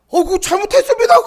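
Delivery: high, loud, flustered apology; mock panicked apology. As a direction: imitate a driver suddenly begging off in alarm. Trucker sees knives, apologizes, flees. (겁먹고 다급히 사과하는 흉내)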